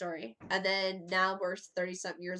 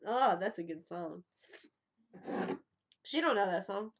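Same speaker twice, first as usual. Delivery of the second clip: ordinary delivery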